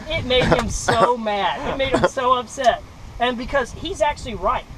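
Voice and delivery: ordinary delivery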